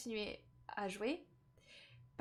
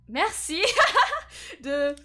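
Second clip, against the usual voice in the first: laughing; 7 semitones higher, 11 dB louder, slower than usual. Real, not acted. Laughs mid-line while reading.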